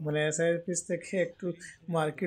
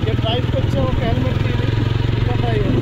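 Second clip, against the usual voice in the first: talking inside a helmet, much louder and higher; raised voice through a helmet on the road; 9 semitones higher, 13 dB louder than usual. Real, not acted. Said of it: "helmet পরে আছি".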